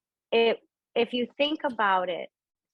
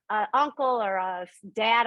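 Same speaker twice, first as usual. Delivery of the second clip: ordinary delivery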